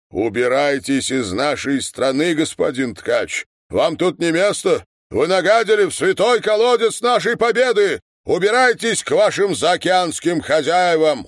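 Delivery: indignant public speech rising in heat; megaphone denunciation. A narrator's reading. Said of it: "заговорил в него своим глухим голосом".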